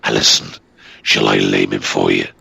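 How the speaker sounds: low growling beast offering harm; talking demon dog. Acted. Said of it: "and the dog talked".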